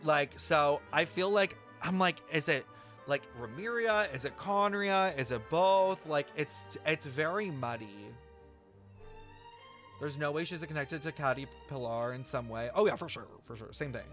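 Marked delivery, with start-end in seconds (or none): none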